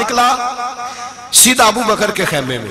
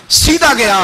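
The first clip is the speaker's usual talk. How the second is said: loud, high burst of urgency; forceful preacher's narration; storming in; 6 semitones higher, 4 dB louder than usual. Acted. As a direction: push it out like he's barging in. Preacher acting Umar storming in.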